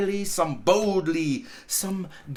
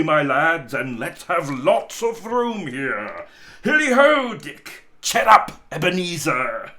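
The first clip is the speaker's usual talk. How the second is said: much louder, booming command; hearty loud order; 8 dB louder than usual. A narrator's reading. Fezziwig telling his lads to clear the floor, booming order. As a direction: bellow it cheerfully to the lads.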